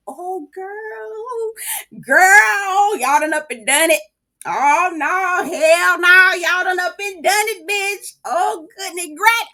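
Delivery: high, hammed-up gossip reaction; scandalized glee. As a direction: squeal it like juicy drama just broke out. Gleeful reaction to cast insult.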